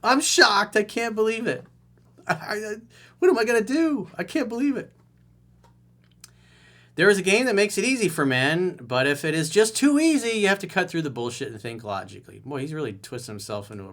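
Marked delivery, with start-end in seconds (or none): 0.0-4.9 high, loud hammed-up shock; mock disbelief. Coach mocks the caller, hammed-up shock. 7.0-12.4 louder, higher, mocking read of the line; mocking reread. Coach quotes the caller to mock him.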